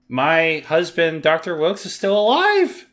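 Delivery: rising high, slow melodrama; mock dramatic reveal. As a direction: draw it out like a soap-opera twist. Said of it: "to reveal".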